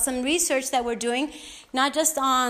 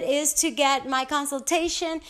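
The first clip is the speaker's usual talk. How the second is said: ordinary delivery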